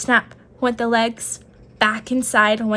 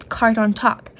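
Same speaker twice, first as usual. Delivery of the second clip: usual speaking voice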